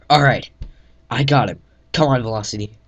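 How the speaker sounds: loud urgent call to move; shouted call. Acted. Narrator calls Velocity to leave, shouting. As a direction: yell to Velocity to pull out.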